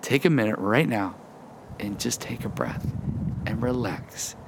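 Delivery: soft, unhurried guidance with pauses; calming. Real, not acted. Preacher guiding listeners to take a breath, calming. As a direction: ease them gently into a breath.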